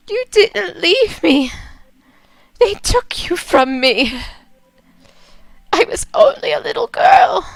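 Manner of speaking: high, breaking cry of grief; a little girl's heartbreak pouring out; Jane, tearful daughter. Acted. Jane sobs to her dead mother, high and breaking.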